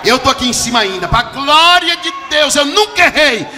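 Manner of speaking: boastful, loudening, high claim; smug churchgoer on the third floor; proud self-righteous believer. Retold or imitated. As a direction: puff up and brag of never falling. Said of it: "dizendo assim".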